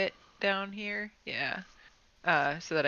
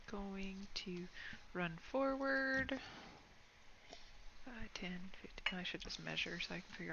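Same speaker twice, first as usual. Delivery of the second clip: whispering; 12 dB quieter than usual. Real, not acted. Whispering moves while counting.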